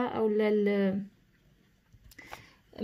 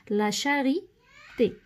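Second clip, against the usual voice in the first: "لا شاريتي" slowly, with a break before the final "تي"; word drawn out; 3 semitones higher, much slower than usual. Shown as a demonstration. Teacher writing out an example for pupils, slow dictation of "لا شاريتي". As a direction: say "لا شاريتي" in pieces, at writing speed.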